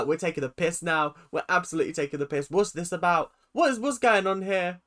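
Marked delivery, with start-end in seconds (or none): none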